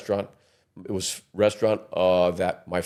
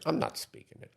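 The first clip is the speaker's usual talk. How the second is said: daughter refusing; 8 semitones higher, 11 dB quieter than usual. Retold or imitated. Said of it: "She goes".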